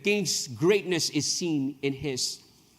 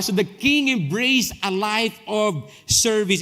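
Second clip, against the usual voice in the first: slow, loud, lifted proclamation; slow preacher's declaration; preaching; 5 semitones higher, 5 dB louder, slower than usual. Acted. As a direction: proclaim it to the congregation.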